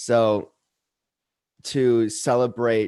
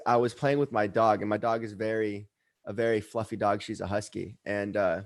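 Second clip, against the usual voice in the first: normal talking voice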